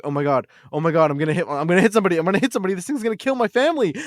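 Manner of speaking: retold panic. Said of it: "I'm like".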